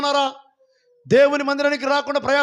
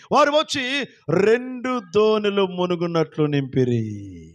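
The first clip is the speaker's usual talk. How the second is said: ordinary talk, nothing marked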